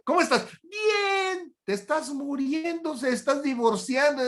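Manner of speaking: high, hammed-up fake happy voice; mock cheerful greeting. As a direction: play the one who says all is fine while falling apart. Mimics a fake "fine" greeting. (voz aguda y exagerada de alegría fingida)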